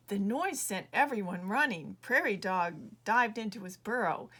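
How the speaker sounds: ordinary delivery, nothing marked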